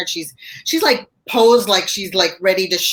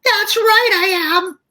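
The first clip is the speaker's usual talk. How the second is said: much higher and louder, huffy retort; indignant agreement; 4 dB louder, slower than usual. Retold or imitated. Stressed friend shooting back a worked-up mock agreement. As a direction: snap back, still worked up.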